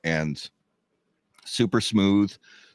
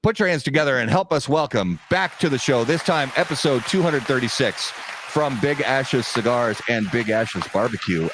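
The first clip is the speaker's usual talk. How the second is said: announcer voice; 4 semitones higher than usual. Acted. Announces guest.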